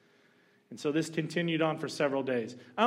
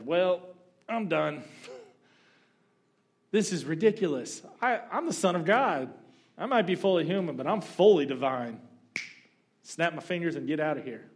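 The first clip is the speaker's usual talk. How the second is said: louder, exasperated mock rant; a hint of indignant Jesus throwing up his hands; fed up; 4 semitones higher, 5 dB louder than usual. Imagining Jesus quitting the cross, exasperated mock rant. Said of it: "I'd be like".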